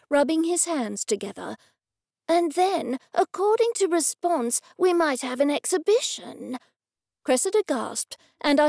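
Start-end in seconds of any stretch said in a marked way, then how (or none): 2.3-6.6 raised pitch, building anticipation; eager offer. A narrator's reading. Gallery owner offers artist an exhibition, eagerly. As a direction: tempt them with it, pitch lifted.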